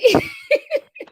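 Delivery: a loud, high burst of laughter. Real, not acted. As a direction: crack up out loud at yourself. Laughing at own procrastination.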